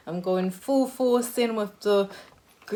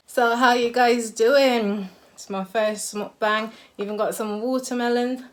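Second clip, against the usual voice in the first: excited; 4 semitones higher, 9 dB louder than usual. Real, not acted. Excited first mukbang greeting.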